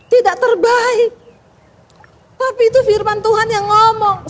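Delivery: high, ringing preacher's voice; preaching at full force. Acted. Pastor urges the congregation to serve God, fervently preaching. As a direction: proclaim it to the congregation.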